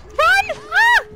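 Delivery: very high, very loud scream into "Ah!"; panicked scream. Real, not acted. Running from the killer, screaming in panic. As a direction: shriek and bolt in real panic.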